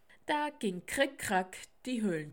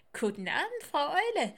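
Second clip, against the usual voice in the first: lively squirrel greeting, rising louder; chittering squirrel; 8 semitones higher, 6 dB louder, faster than usual. Acted. Squirrel greets Mama Owl in passing, chittering. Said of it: "Eichhörnchen vorbei und kickerte".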